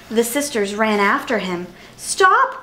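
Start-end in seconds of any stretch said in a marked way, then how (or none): 2.0-2.6 high-pitched urgent call; crying out. A narrator's reading. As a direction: call out high to halt someone running off. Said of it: "cried one".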